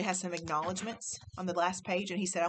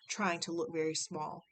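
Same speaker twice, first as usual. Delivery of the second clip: ordinary delivery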